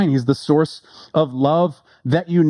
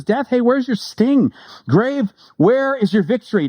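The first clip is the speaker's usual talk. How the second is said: declaiming Paul; 8 semitones higher than usual. Acted. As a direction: lift the voice to mock death's sting. Preacher quotes Paul.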